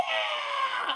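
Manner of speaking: shrieking laugh, very high. Real, not acted. Laughing after impression.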